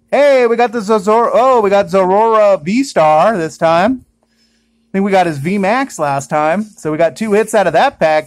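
loud delighted announcing; excited yelling. Real, not acted. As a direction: practically yell it in someone's ear, thrilled. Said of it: "feel like I'm yelling in your ear".

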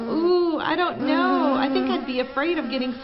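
plain reading voice

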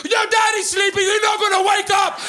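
very high imitation of Mom yelling; yelling mother. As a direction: play a mother scolding at the top of her range. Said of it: "I remember her going".

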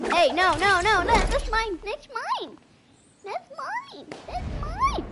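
whiny that's mine, climbing high; possessive whining. Real, not acted. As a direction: whine to claim your loot. Someone grabbing her loot.

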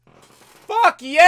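loud, high whoop of joy; excited shout. Real, not acted. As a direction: yell it out in celebration. Celebrating passing six hundred subscribers.

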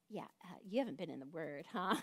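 lightly voiced husband ribbing; husband teasing. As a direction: tease her dryly. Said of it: "And he'd be like".

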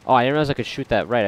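ordinary delivery

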